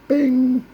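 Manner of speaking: high bright bing sound. Acted. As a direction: chime it out high.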